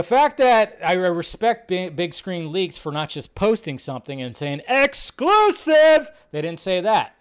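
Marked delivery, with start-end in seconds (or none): 4.7-6.1 high, loud, drawn-out hype shout; mock scoop announcement. Streamer mimicking leakers who brag about scoops, mock hype announcement. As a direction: stretch it out like a breaking-scoop herald.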